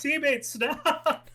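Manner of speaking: high, drawn-out mock cry; desperate plea. Player blocking his teammate's fire, desperate drawn-out plea. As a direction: stretch it out high, begging.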